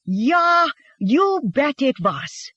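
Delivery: high, drawn-out mock Swedish retort; exaggerated Swedish accent. A narrator's reading. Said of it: "using the exaggerated Swedish accent now".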